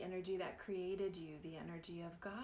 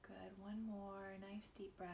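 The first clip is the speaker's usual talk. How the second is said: gentle meditation guide with long pauses; guided meditation; 6 dB quieter than usual. Real, not acted. Guide inviting listeners to close eyes and breathe, guided meditation. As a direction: ease them into breath and softening, leaving space between phrases.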